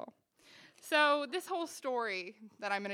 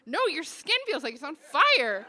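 loud, high cry of alarm, imitating a date; alarmed exclamation; 6 semitones higher, 10 dB louder than usual. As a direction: exclaim it, punching the key words loudly.

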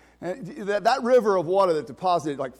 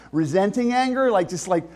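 ordinary delivery

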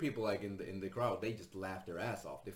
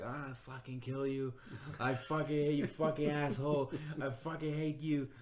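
higher, mock furious drunk screaming; hammed-up drunken fury, a bit higher; drunken rage; 6 semitones higher than usual. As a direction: imitate a drunk girl yelling into a mic. Drunk girl grabs mic raging.

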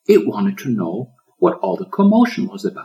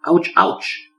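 yelping ouch; 10 semitones higher, 3 dB louder than usual. A narrator's reading. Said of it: "he mumbled".

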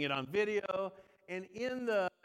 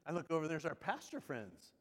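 normal talking voice

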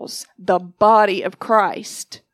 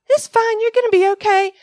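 mock breezy comfort; 11 semitones higher, 4 dB louder than usual. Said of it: "Don't just tell people".

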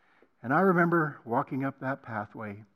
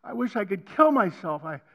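builds from a plea to a loud, high, anguished cry; retold anguished prayer climbing to a loud high cry; desperate cry to God; 7 semitones higher, 9 dB louder than usual. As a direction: beg God to make it stop, rising to a shout. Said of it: "I cried out".

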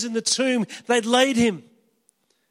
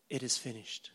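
soft last words; 9 semitones lower, 15 dB quieter than usual. Acted. Jesus's last words on cross.

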